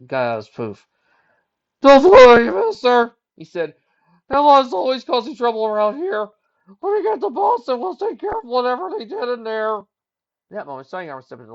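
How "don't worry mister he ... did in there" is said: high, bright character voice, quick and helpful; eager young colt. Acted. Ticket colt reassuring Silver Streak in his own voice.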